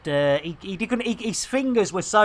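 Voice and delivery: normal talking voice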